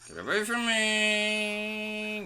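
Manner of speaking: high, slow, stretched-out plea; high silly voice. Pretend. Gamer whining high at a ghost. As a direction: whine it up high and drawn out.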